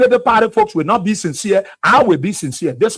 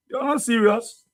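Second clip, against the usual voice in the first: ordinary talk, nothing marked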